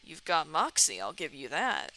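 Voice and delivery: low character voice; Colonel Sanders. Acted. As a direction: drop low for a grudging compliment. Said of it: "Colonel Sanders' voice is all over".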